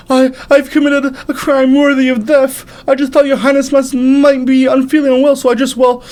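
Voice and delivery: high, slow, quavering plea; terrified servant. Acted. Servant begs the angry prince, terrified high pleading. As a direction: grovel in fear for your life.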